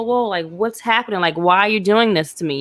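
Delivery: ordinary delivery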